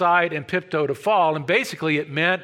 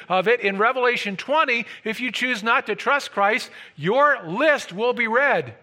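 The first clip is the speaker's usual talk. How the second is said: ordinary talk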